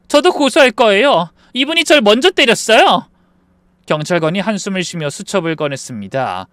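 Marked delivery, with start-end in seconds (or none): 0.1-3.1 loud, high counter-accusation; indignant retort. Acted. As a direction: fire the charge back at her. Daughter-in-law counter-accuses to police.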